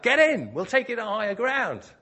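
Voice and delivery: high, loud rescuer's shout; big acted holler across water; yelled call. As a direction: yell urgently from a lifeboat. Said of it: "they yell out".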